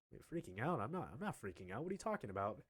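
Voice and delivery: soft, baffled protest; understated denial. Retold or imitated. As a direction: quietly insist you are calm. Denies freaking out, retold.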